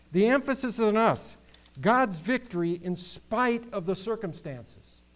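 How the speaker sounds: high, loud, emphatic pulpit delivery; fervent sermon climax; full-force preaching. Real, not acted. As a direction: drive the point home to the congregation.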